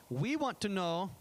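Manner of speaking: slow, put-on Israeli accent on "We want to know"; lightly sketched Israeli accent, imitating the teens; mock Israeli accent. Israeli high schoolers questioning the pastor, in a light mock Israeli accent. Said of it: "I have to give them some accent".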